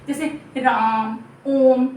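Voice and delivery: ओम called out loud with the vowel held long; drawn-out calling vowel. Shown as a demonstration. Teacher showing students the pluta vowel, a long loud call. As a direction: stretch ओम as if calling someone from far away. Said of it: "किसी के नाम को पुकारने में".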